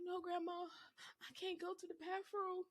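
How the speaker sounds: put-on high, whiny little-girl voice; high child's voice pleading with grandma; little girl refusing. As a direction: play the scared kid saying no.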